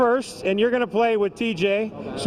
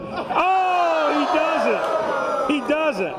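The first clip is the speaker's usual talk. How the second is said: excited; 7 semitones higher, slower than usual. Real, not acted. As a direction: cheer the winning throw. Live toss, he scores.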